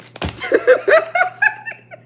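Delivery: delighted high-pitched laugh; high cackling laugh. Real, not acted. Laughing at own singing.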